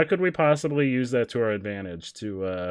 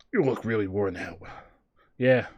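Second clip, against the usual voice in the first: lower, put-on tired dad voice; 5 semitones lower than usual. Dad telling his kid they look worn out, in a lower voice. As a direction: play the weary father, low. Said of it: "I give Dad a different voice every night".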